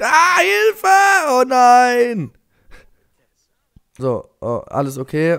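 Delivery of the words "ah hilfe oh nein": very high, loud squeal for help; hammed-up terrified baby's thought; mock panicked child. Player voices game baby's panicked thought, mock terror. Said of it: "das Kind denkt".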